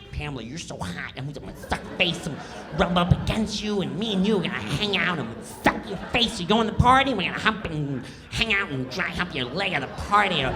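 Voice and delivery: lightly put-on lecherous voice; sleazy character. Comic imitates Kattan's sketch character, lightly voiced sleaze. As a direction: leer through the lines, rushed.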